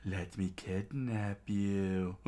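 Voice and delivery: slow, creepy villain coaxing; demented bandit. A narrator's reading. Demented bandit coaxing the cornered girl, creepy. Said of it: "the demented one popped up".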